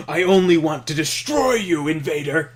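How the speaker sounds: loud hammed-up evil leader; mock villain threat. Joking fake line for Trakov, loud mock villain shout. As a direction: roar the threat like a cartoon villain. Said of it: "I like the first one".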